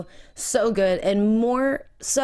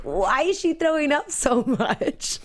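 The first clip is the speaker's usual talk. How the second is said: put-on slow, higher puzzled kid voice; imitating a kid; 7 semitones higher, slower than usual. Retelling the son's confused question, mock baffled. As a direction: ask it like a baffled son. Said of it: "for James to be like".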